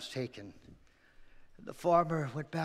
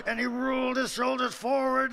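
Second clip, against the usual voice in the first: plain reading voice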